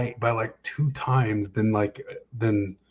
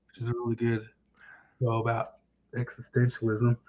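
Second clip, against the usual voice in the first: ordinary delivery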